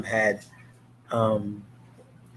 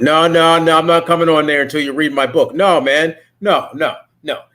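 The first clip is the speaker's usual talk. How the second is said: loud, firm mock refusal; a hint of a stubborn father saying no; lightly voiced dad digging in; imitating dad refusing; 4 semitones higher, 5 dB louder than usual. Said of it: "At first, he was like".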